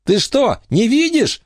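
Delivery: high-pitched indignant cry; shouting. A narrator's reading. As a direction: cry out at her in disbelief. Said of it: "закричала Эмма". (возмущённый крик)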